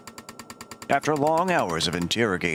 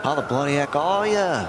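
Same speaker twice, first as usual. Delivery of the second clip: mock Aussie greeting, a bit higher; Australian accent; 4 semitones higher than usual. Streamer greets game characters in Australian accent. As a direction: ham up an Australian bloke.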